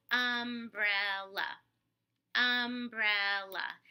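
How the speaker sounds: umbrella split into slow loud beats, um-brel-la; tapped-out syllables. Shown as a demonstration. Therapist taps out umbrella for the child, slow syllable beats. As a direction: tap each syllable of umbrella out loud, slowly. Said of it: "hand over hand tapping".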